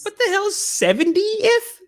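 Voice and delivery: can't believe what the clip just said; incredulous. Real, not acted. Disbelief at the clip's number.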